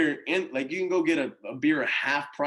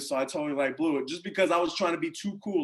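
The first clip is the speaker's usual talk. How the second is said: ordinary talk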